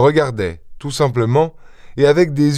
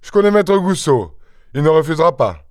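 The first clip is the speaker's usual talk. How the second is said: higher-pitched, assured innkeeper; confident innkeeper; 5 semitones higher than usual. A narrator's reading. Innkeeper vouching for maître Goussot, confident. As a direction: reassure with ready confidence, pitched up.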